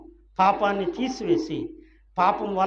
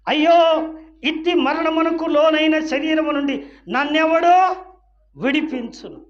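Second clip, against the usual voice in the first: anguished cry of Paul; 8 semitones higher, 6 dB louder than usual. Acted.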